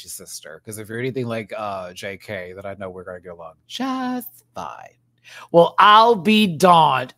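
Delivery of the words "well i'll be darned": loud, slow, higher punchline delivery; exaggerated exclamation. Shown as a demonstration. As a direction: belt it out and stretch it like a big punchline. Trying out the pun loudly.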